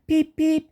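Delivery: engine whistle in a high voice; high whistle peep. A narrator's reading. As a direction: toot it high and bright. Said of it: "he whistled to Duncan".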